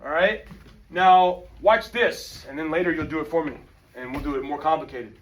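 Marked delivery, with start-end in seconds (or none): none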